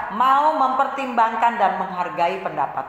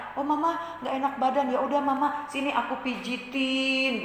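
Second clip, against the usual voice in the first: put-on higher, soft caring child; sweet, quiet, understanding child voice; gentle child; 5 semitones higher, 5 dB quieter than usual. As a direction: offer help to mama tenderly. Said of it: "anak yang lemah lembut itu".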